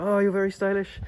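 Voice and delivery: high, different voice, admiring; old man imitation. Old man admiring the form, high imitated voice.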